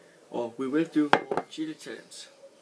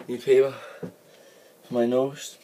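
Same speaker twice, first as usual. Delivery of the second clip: urgent; 7 dB louder than usual. Real, not acted. Burning mouth, runny nose.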